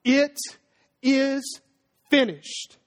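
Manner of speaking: halting, each word set apart by pauses; acted last cry, rising then falling away word by word; dying cry. Preacher voicing Jesus crying out with his last breath, halting cry. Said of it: "last ounce of breath in him, Jesus cried out".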